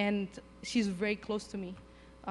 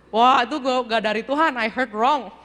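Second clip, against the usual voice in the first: comic disbelief; 2 semitones higher, 8 dB louder than usual. Retold or imitated. Missionary insisting that command wasn't from God, loudly incredulous. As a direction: brush it off loudly, heading for a laugh.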